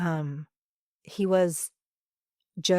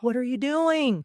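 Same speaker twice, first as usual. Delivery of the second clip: incredulous; 7 semitones higher than usual. Retold or imitated. Teacher reacting to discouraging parents.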